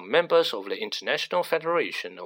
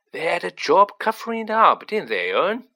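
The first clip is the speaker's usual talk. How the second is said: lightly voiced gossipy conductor, higher and louder; chatty character voice, pitched up; Stan; 5 semitones higher, 5 dB louder than usual. A narrator's reading. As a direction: gossip eagerly, seeking agreement. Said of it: "Stan said".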